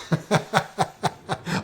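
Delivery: real, breathy chuckling burst; hearty laugh. Real, not acted. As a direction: break into a run of amused laughter. Amused at being beyond words.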